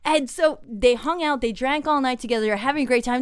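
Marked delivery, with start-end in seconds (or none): none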